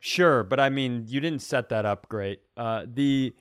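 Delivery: ordinary talk, nothing marked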